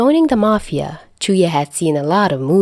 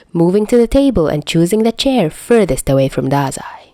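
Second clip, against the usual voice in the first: ordinary delivery, nothing marked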